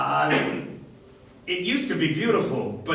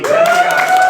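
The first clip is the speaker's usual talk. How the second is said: high, loud call across the room; 9 dB louder than usual. Real, not acted. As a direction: shout her name to get her back. Calling someone back.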